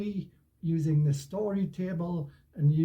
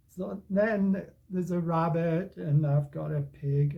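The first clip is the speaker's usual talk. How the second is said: usual speaking voice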